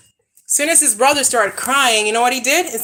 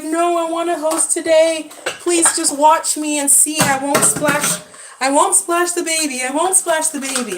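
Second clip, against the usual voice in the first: imitating pleading kid; 7 semitones higher, 3 dB louder than usual. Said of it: "He's like".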